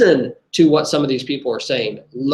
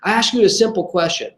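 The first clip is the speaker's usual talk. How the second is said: livid retort; 7 semitones higher than usual. Retold or imitated. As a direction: fire it back, furious and gasping for justice. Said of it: "He is livid".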